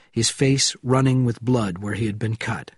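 ordinary delivery

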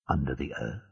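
usual speaking voice

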